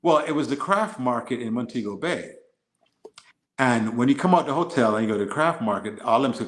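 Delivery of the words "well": ordinary talk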